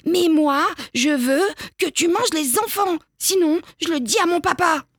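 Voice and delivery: put-on high-pitched raging kid; child's tantrum. A narrator's reading. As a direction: stamp and demand like a small child. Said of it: "Biquet pique une colère. Il rage".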